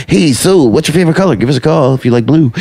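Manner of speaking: mock a phone-in DJ; bad morning DJ. Host mocking morning radio talk, hammed DJ voice. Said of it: "that really bad morning".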